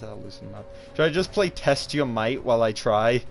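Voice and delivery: normal talking voice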